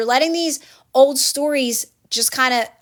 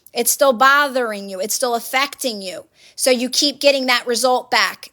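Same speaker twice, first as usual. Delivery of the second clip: ordinary talk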